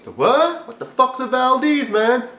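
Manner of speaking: high, puzzled voice of a clueless person asked about the Valdez; lightly voiced baffled dude; confused bystander. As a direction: play the confused guy who's never heard of it. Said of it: "You're gonna ask people".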